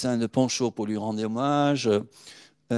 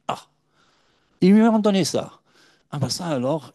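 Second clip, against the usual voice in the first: high, loud cry of astonishment; astonished Dane; 8 semitones higher, 7 dB louder than usual. Retold or imitated. A Danish official, amazed at the gold medal, cries out high and loud. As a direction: gasp out the surprise. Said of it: "Il m'a dit".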